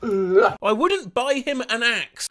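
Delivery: ordinary talk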